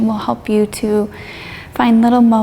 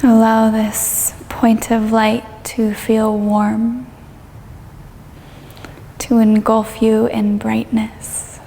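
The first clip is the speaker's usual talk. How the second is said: guided meditation. Real, not acted. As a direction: ease them gently through it, drawing out the phrases.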